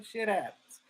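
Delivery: soft, throwaway "shit happens"; offhand shrug. Acted. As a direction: toss off "shit happens" quietly. Shrugged-off first take of phrase.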